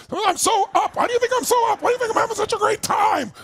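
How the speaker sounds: high shouted glee; hammed-up joy; mock excited. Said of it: "look at your body".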